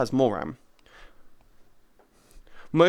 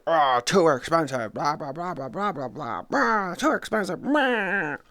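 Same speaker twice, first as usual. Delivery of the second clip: mock whiny complainer.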